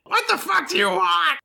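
high, snarling "What the fuck do you want?"; rough half-orc lady. Acted. Half-orc girlfriend snaps at the intruders in her doorway. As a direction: snap at the intruders. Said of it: "skeezy looking half orc lady".

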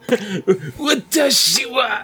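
high slow anime shout, laughing; having a heart attack. Pretend. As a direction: cry out dramatically, clutching chest. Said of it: "Clutch my chest, say something in Japanese".

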